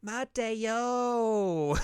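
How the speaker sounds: high sing-song calling of a name. Retold or imitated. Rise calling into the house, high and cute. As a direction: call out cutely.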